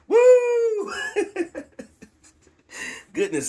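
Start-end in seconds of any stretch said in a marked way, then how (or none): 0.1-1.6 very high, loud whoop into laughter; real triumphant whoop; gleeful whoop. Real, not acted. Gleeful over enemies' downfall.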